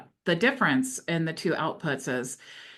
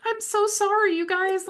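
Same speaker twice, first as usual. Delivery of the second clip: high, louder flustered apology, imitating herself; retold panicked apology; 14 semitones higher, 4 dB louder than usual. Retelling how she apologized to the webinar audience, mock panicked. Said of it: "I'm panicked and sweating".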